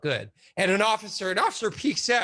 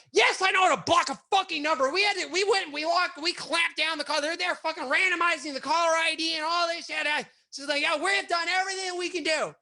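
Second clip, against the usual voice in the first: imitating an exasperated shout; 9 semitones higher than usual. Boss snapping at the cops about blocking numbers, exasperated. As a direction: snap back at the cops.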